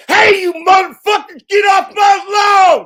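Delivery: high, loud, slow yell; cranky old man shout. Pretend. A grumpy neighbour yelling at the kids on his lawn, furious. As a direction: roar at the kids to get off your lawn. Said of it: "I feel like Glitch Dash".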